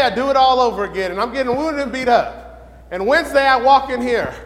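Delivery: ordinary delivery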